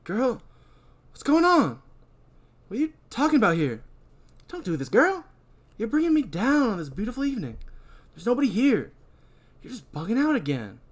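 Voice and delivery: high, breezy, quick stoner talk; Rudy laid-back. Acted. Rudy waving off the girl's panic. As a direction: brush her off, easygoing. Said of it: "Rudy says".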